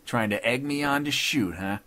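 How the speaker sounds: snarling. A narrator's reading. Gunman accusing the judge of goading, snarling. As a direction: challenge him with a snarl. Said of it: "snarled Dodd".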